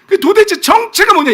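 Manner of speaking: exasperated, high-pitched shout; God. Acted. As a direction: demand an answer loudly. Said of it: "이 말씀을 하나님이 우리한테도". (답답해하며 크게 호통치는 하나님)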